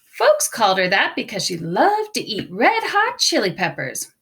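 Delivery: plain reading voice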